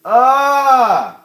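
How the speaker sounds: long, high, loud "aah"; scream of pain. Real, not acted. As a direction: let out a drawn-out pained scream. Onion burn in the eyes.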